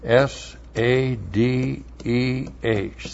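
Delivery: "S A D E H" spelled slowly and softly; spelling out letters. Shown as a demonstration. Teacher spells a Hebrew word for the class, softly. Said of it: "And then we have sade".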